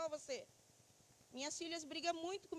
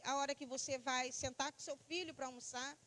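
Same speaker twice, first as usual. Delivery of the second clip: ordinary talk, nothing marked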